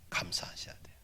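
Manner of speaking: sudden unvoiced whisper. Real, not acted. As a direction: lean in and whisper it. Sudden whisper after loud lines.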